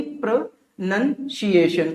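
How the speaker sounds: प्रोनंसिएशन split pro-nun-see-ay-shun; syllable breakdown. Shown as a demonstration. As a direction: sound out प्रोनंसिएशन in parts, with "nun". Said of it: "Hindi में भी breakdown करके लिख दिया". (प्रोनंसिएशन को "प्रो-नन-सिएशन" तोड़कर बोलें)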